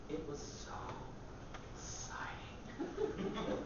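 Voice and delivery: slow, whispered mock excitement; breathy thrill. Performer reliving relief at a gas station, whispered thrill. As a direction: draw it out in a hushed, aroused whisper.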